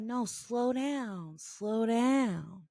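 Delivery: slow, drawn-out mock command; retold order, dragged out without shouting; insistent retold order. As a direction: imitate a man insisting, stretching each word. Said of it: "And he's like".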